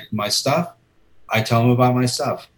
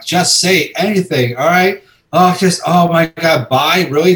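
higher, louder mock exasperation at yourself; self-scolding; 7 semitones higher, 7 dB louder than usual. Panicked inner voice scolding the frozen fan, mock exasperated. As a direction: berate yourself in your head, half laughing.

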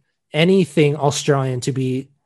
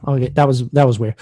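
normal talking voice

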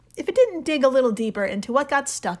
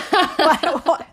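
laughing; 6 semitones higher, 6 dB louder than usual. Real, not acted. Laughing at the question.

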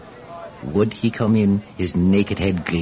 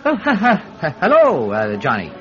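flustered greeting; 3 semitones higher than usual. Acted. Cap thief greeting his victim Johnny, flustered. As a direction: cover the shock with nervous ha-has.

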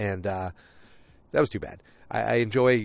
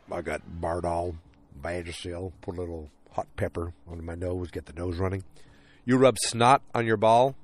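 put-on older pitcher; 3 semitones lower than usual. Older pitcher explaining his tricks to a teammate, lightly imitated low voice. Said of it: "he's the older pitcher in Major League".

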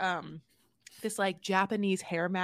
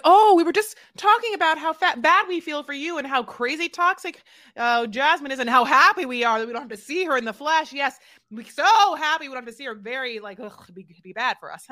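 fast, loud, high mocking gush; mock fake sympathy; 4 semitones higher, 8 dB louder, faster than usual. Co-host mocks the women's fake sympathy, fast and loud. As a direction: lay the fake pity on thick.

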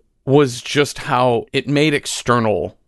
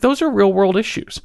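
emphatic; 11 semitones higher than usual. Retold or imitated. Telling the church crowd those are real-world issues, emphatic and high. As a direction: stress it like an obvious truth. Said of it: "I'm like".

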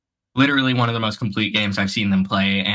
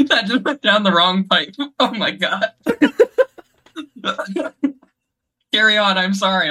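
laughing; 11 semitones higher than usual. Real, not acted. Laughing after choking on drink.

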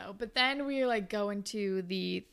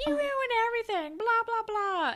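mock yell, much higher and a bit louder; Manny screaming at Jay during their fight, hammed-up yell; 14 semitones higher, 4 dB louder than usual. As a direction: imitate her screaming, trailing into blah blah. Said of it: "a screaming fight".

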